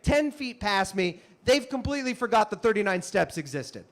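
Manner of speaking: ordinary delivery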